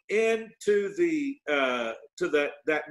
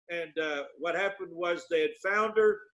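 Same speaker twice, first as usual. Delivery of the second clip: normal talking voice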